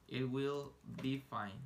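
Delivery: "It will be fine" drawn out slowly and clearly; patient teacher pacing; slow model. Shown as a demonstration. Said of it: "teacher models English slowly".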